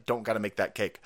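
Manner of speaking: usual speaking voice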